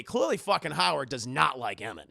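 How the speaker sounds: normal talking voice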